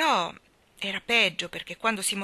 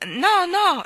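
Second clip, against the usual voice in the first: high, loud impatient cry; impatient little-girl protest; impatient interruption; 12 semitones higher, 12 dB louder than usual. Acted. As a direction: cut in impatiently at high pitch. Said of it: "lo interruppe Annabella con impazienza".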